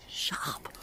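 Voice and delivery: single hushed whispered word. Real, not acted. Ghost hunter whispering a startled reaction. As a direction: breathe it out as a whisper.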